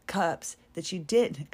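ordinary talk

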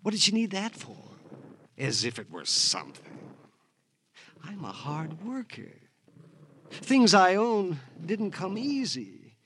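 a broken man clinging to his things; mild, high, wavering lament; unhinged lament. Acted. Unhinged rambling after arrest.

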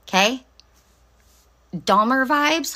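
ordinary talk

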